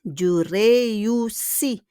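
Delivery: "Je réussis" slowly, ending on a long ee; silent final S. Shown as a demonstration. Teacher repeats the conjugated verb, final S silent. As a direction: stretch "Je réussis", dropping the last S. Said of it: "We don't pronounce the S".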